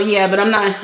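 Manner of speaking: normal talking voice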